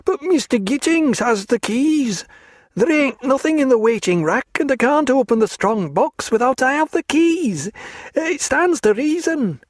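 a frightened lad begging rescue; tearful clerk. A narrator's reading. Clerk pleads to Stephen as to a saviour.

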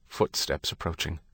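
ordinary delivery

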